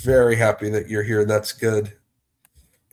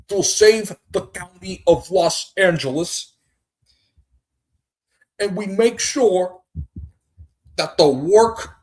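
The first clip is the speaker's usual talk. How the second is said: much higher, slow, halting politician voice; George Gascon impression; 8 semitones higher than usual. Streamer mocks the LA District Attorney, halting high impression. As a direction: stretch every phrase like a pompous DA. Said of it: "That is my impression of George Gascon".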